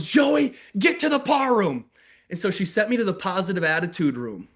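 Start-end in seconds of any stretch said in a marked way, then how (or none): 0.0-1.9 high-pitched retold teacher yell; mock teacher scream. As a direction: shriek the kid's name like a fed-up teacher. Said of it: "screamed at me like that".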